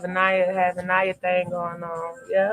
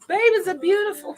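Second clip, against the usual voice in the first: laughing; 11 semitones higher, 3 dB louder than usual. Real, not acted. Giggling over baby prophecy.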